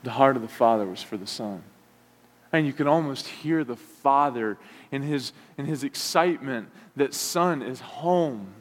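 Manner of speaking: ordinary talk, nothing marked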